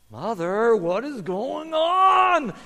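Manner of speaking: drawn-out, rising wail of a question; bewildered child. Acted. Young donkey asks mother as strangers lead them away, bewildered. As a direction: stretch it out high, like a puzzled youngster.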